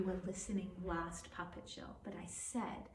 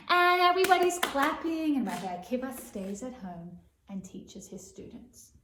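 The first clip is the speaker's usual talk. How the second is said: plain reading voice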